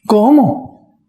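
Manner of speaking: put-on granny question; elderly lady. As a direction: ask it as the old lady, taken aback. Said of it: "una señora muy querida".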